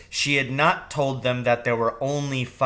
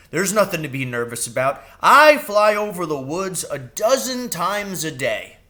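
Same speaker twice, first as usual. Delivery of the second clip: quick, easy comfort rising higher; kindly reassuring crow; 5 semitones higher, 5 dB louder than usual. A narrator's reading. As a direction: reassure her breezily. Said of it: "he said kindly".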